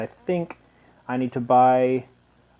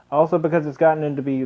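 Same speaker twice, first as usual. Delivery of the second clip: ordinary talk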